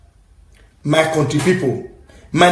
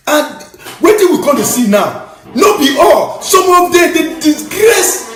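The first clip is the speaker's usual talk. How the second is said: indignant shout; 9 semitones higher, 4 dB louder than usual. Real, not acted. Indignant outburst about disgrace.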